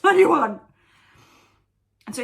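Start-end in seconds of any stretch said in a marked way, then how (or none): 0.0-0.6 much louder, much higher yell, hammed-up; big mock shout after a laugh; shouted shitty retort. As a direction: re-enact the retort as an exasperated yell. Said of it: "really shitty".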